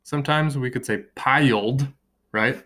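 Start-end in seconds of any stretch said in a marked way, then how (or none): 1.1-1.9 piled said as PIE-uld; piled broken after the long i, pie-uld; piled in two syllables. Shown as a demonstration. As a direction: stretch piled into two beats, pie-yuld. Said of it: "That would give us two syllables".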